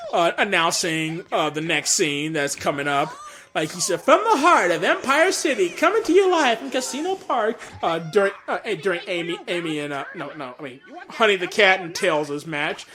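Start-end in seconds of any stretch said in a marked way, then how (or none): 4.0-7.6 high-pitched, loud tournament announcer; Wes Weasley. Acted. Tournament announcer introducing the next scene, showy announcer voice. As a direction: announce it big, live to the crowd. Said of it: "Wes Weasley, the tournament announcer, announcing".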